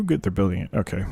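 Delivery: ordinary delivery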